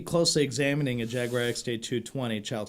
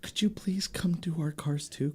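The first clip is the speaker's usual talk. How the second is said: pleading whisper; 2 semitones higher, 7 dB quieter, slower than usual. Retold or imitated. Panicked guy begging for help.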